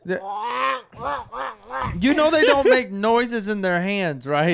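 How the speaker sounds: high, loud laughing disbelief; laughing, can't believe it. Real, not acted. Mocking friend's duck call belief.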